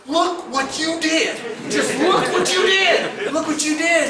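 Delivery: high, loud, slow laughing praise; proud partner praising the angler's catch, laughing loudly; thrilled praise. Retold or imitated. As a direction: crow it with delight.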